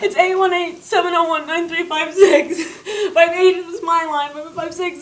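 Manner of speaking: pushed high, with a laugh; reciting the numbers in a rush of panic. Retold or imitated. Scared girl giving police the real number, pleading. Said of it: "I said".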